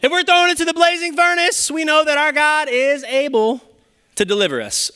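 high, ringing pulpit cry; preaching at full force. Acted. Preacher proclaiming to the congregation at full force. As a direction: lift it up and proclaim.

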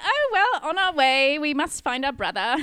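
high, loud, hammed-up British accent; bad British accent. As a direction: declare it in a theatrical fake British voice. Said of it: "the worst British accents you've ever heard".

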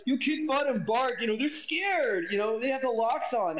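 mock him in a high drawn-out voice; a squeaky, dragging parody of his bravado; high mocking impression.

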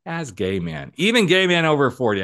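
rising to a loud, high "even"; emphatic build. Real, not acted. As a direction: punch "even" hard and high. Emphatic point in monologue.

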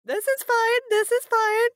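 high, strained "this is fine"; mock calm. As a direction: squeak it out pretending all is well. Player mock-calm after bad roll.

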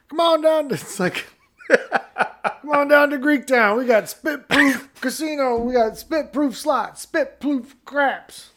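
a hammed-up southern accent pitching the casino. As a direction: sell it like a hokey southern casino ad. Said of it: "person at Greektown ... southern accent".

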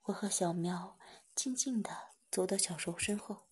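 ordinary delivery, nothing marked

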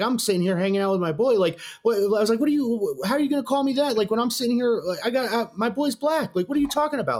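high-pitched, worked-up complaint; retold outrage, rising into incredulity; indignant protest. Customer protesting to manager about being insulted, indignant. Retells confronting manager over slur.

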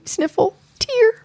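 high, pouty pretend hurt; mock sniffling. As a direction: play wounded, spelling out fake tears. Mock hurt at being teased.

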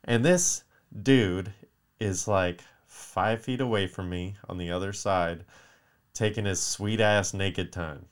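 usual speaking voice

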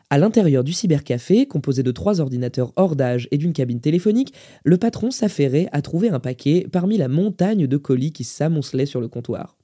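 plain reading voice

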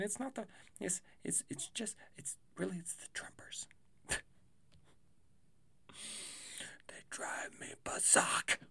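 hushed conspiratorial whisper. Real, not acted. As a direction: whisper it like a secret gripe. Whispered jab at Trumpers.

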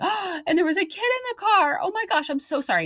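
high-pitched, distraught apology; acted upset. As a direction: imitate a panicked young driver apologising. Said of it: "She was just so upset".

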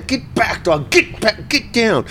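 scolding the dog. Retold or imitated. Retold owner shooing the dog away, mock stern. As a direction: snap at the dog to back off.

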